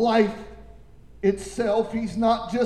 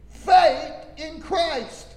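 slow emphasis; 6 semitones higher, 3 dB louder, much slower than usual. Real, not acted.

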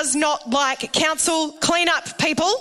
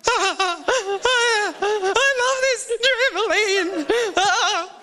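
put-on much higher, slow, sobbing child with a laugh; the son on his broken trampoline, crying; imitating crying boy; 8 semitones higher, much slower than usual. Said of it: "he's holding onto the side going".

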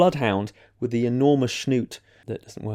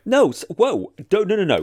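high, raised-voice string of protests; alarmed objection; 7 semitones higher, 3 dB louder than usual. Real, not acted. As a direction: cut in high and insistent to object. Objects to calling dog sexy.

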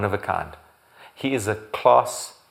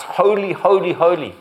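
high, loud proclaimed cry; loud, high ringing praise; 6 semitones higher, 9 dB louder than usual. Acted. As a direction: cry it out like the heavenly creatures. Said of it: "who night and day cry out".

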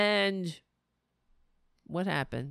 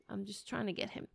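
usual speaking voice

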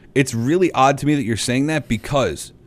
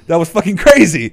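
excited; 7 semitones higher, 7 dB louder than usual. Real, not acted. Excited after crowd imitation.

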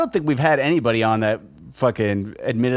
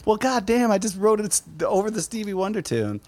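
mock exasperation, pitched much higher; exasperated guest; 9 semitones higher, 3 dB quieter than usual. Host imitating a guest's dismay, high and mock-exasperated. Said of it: "He's like".

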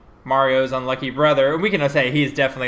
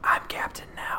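whisper; 8 dB quieter than usual. Real, not acted. Whispered aside.

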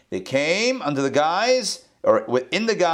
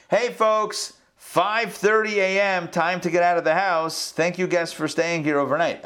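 raised-pitch mock-cheerful announcement; host's wake-up call; 4 semitones higher than usual. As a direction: rouse the guests with a sing-out. Acts a host waking guests.

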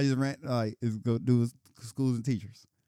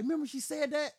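friend's question voiced much higher; high retold friend; 12 semitones higher than usual. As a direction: pitch it up as the old classmate. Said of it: "he's like".

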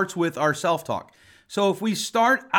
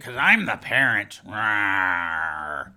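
lower, hammed-up parent's retort; mock growly parent; 10 semitones lower, much slower than usual. Parent excusing themselves to the therapist, mock growl. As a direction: imitate a defensive parent, sinking into a growl.

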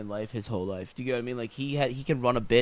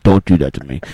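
deep mock voice; 12 semitones lower, 7 dB louder than usual.

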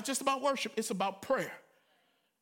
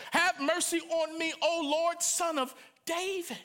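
higher, pleading cry; 7 semitones higher than usual. A narrator's reading. Canaanite woman crying out to Jesus. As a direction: plead for mercy. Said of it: "a Canaanite woman cried out".

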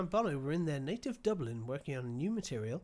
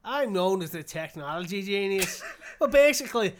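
put-on louder Irish accent that keeps slipping into Scottish; 2 semitones higher, 10 dB louder than usual. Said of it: "I can't keep it from going Scottish".

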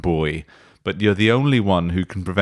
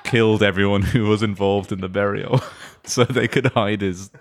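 ordinary talk, nothing marked

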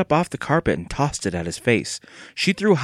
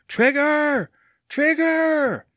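high, drawn calls into the wind; shouting; much slower than usual. A narrator's reading. Evan calling his runaway dog against the wind, shouting. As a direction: shout the name high, as if far behind. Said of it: "He tried shouting louder".

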